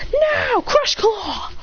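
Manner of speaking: high, slow attack shout; battle command. Acted. Trainer seizing the moment to strike, high drawn-out shout. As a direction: shout the move, stretching each word.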